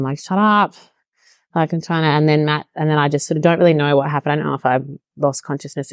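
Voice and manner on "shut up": mild mock yell. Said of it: "I sort of yelled at them".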